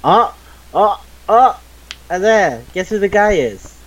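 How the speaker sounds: high-pitched, eager burst of realisation; excited discovery. Real, not acted. Host spots a favourite actor for a friend, excited. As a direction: blurt it out high, thrilled by the find. Spotting a familiar actor in credits.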